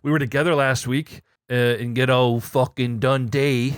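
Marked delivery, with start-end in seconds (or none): none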